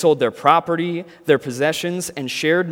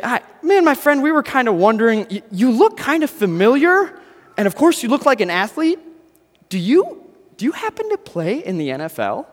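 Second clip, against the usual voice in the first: much higher, eager and a bit louder; starstruck fan; 9 semitones higher, 3 dB louder than usual. Acted. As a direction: gush at the big athlete. Starstruck fan approaching athlete.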